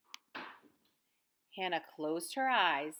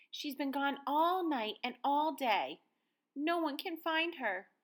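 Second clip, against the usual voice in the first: worried, pleading; 7 semitones higher than usual. A narrator's reading. Violet P telling the neighbours her dog is lost, anxious. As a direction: let the worry rise as she pleads.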